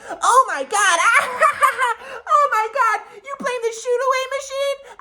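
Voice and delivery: shrieky, very high imitation of a cackling mom; obnoxious mocking laughter. Mom ridiculing son's shooting machine excuse, obnoxious high-pitched laughing. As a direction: laugh ridiculously hard through the words, way up high. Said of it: "laughing and being ridiculous, being really obnoxious".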